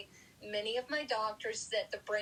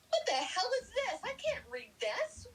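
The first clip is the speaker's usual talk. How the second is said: confused outburst; 12 semitones higher than usual. Retold or imitated. Confused patient reading magazine.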